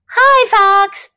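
put-on high-pitched child character voice; high storybook kid; Clark, cheerful little brother. A narrator's reading. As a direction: greet him in a high little-boy voice. Child character greets Fox.

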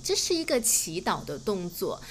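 ordinary talk

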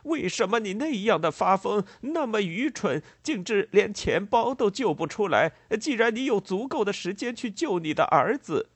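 loud, high-pitched furious scolding; enraged shouting. A narrator's reading. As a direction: berate them at the top of your range. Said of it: "叫道". (暴怒地高声责骂)

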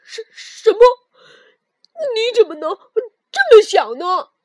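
loud, upset protest; agitated. Acted. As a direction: protest the accusation. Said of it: "跳跳有点激动".

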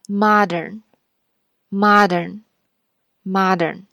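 modern said very slowly, mah-dern with R in the second syllable; careful American modern. Shown as a demonstration. Teacher drills modern three times for students. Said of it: "我们把这个词读三遍".

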